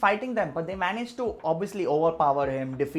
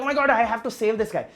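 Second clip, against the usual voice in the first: urgent hero panic; 5 semitones higher, 6 dB louder, faster than usual. Retold or imitated. Shah Rukh's panic, acted quote.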